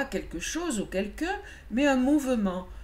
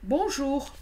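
bonjour lancé; 7 semitones higher than usual. Acted. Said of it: "lança-t-il".

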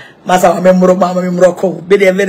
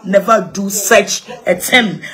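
ordinary talk

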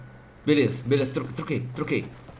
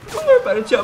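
exasperated plea; 6 dB louder, faster than usual. Real, not acted. Begging the static to stop.

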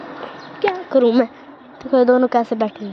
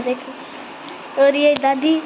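ordinary delivery

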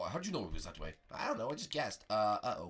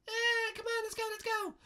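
very high falsetto urging; Princess Peach; 5 dB louder than usual. Pretend. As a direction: squeak at them to hurry. Peach hurries them along.

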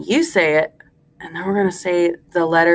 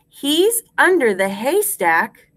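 higher, full-voiced, rising at the end; measured rhyme recitation; 4 semitones higher than usual. Shown as a demonstration. Teacher gives class a line to repeat, higher and clear.

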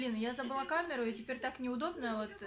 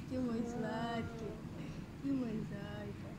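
нежное воркование; 5 dB quieter, slower than usual. Real, not acted. Mother cooing to her baby.

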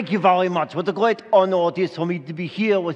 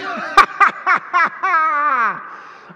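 very high forced laugh; hammed-up laugh; 12 semitones higher, 5 dB louder than usual. Comedian angel enjoying his gag, hammed up. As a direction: crack up at your own gag.

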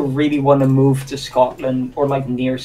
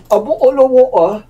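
higher British glottal-stop "bottle of water"; British accent; 9 semitones higher, 4 dB louder than usual. Shown as a demonstration. Gamer demonstrating British speech, accent with glottal stops. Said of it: "they don't actually say the T".

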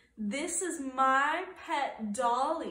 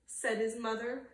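ordinary delivery

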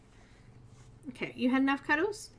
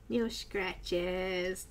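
usual speaking voice

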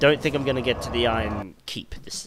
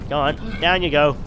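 louder, higher, egging them on; gleeful urging; 4 semitones higher, 7 dB louder than usual. Real, not acted. Gamer urging enemies over the edge, gleeful. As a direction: send them off with glee.

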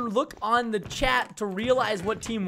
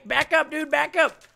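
sharp, raised order to retreat; urgent call; 6 semitones higher than usual. Real, not acted. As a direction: call out higher, urgently warn them off. Calling out to player on base.

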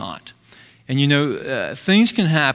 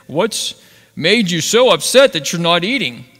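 a hint of a gruff, demanding queen, higher and louder; lightly voiced bossy wife; gruff Jezebel; 6 semitones higher, 7 dB louder than usual. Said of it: "Remember I said she was the gruff lady".

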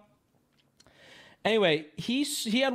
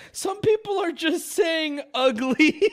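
high, slow, laughing while speaking; 11 semitones higher, 5 dB louder, slower than usual. Real, not acted. Streamer reading insults from chat, laughing as he talks. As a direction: giggle through the line.

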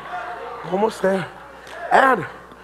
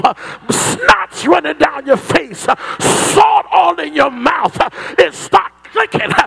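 driving gospel sermon cadence; full-force preaching; 5 semitones higher, 5 dB louder than usual. Acted. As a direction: build to a high, shouted final phrase. Preaches to a climax.

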